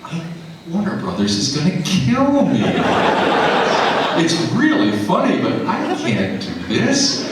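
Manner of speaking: high, laughing panic; rising alarm, half laughing; panicked inner voice. Retold or imitated. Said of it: "I'm thinking".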